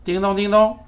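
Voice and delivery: high, loud sing-song call; calling wake word. Real, not acted. Wake word called to speaker.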